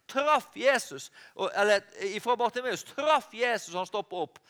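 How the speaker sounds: ordinary talk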